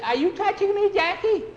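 very high, loud squeal; frightened, shrieked query; panicky question. Acted. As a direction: ask your friend in dread. Scared boy grabbed in dark.

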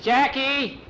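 high, loud call of the name; boy crying out to Jackie in alarm. Acted. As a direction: shout his name across the yard.

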